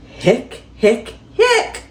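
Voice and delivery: playful pretend hiccups; cartoon hiccup sounds; mock hiccups. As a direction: act out silly hiccups. Skeleton with hiccups book.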